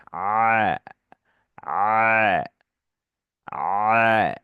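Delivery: কণ্ঠ চেপে আ; throat-pressed aa. Shown as a demonstration. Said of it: "কণ্ঠের মধ্যে চাপ হবে".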